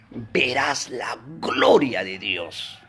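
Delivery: slow, low and hushed with weight; grave, unhurried divine promise; solemn promise as Jesus. Acted. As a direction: draw each word out low, with awe.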